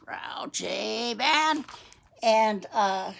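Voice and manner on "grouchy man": slow, rasped growl; grouchy. Acted. Showing the grouchy man's face, grouchy growl. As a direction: growl it out, dragging each word. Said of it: "see how grouchy that face is".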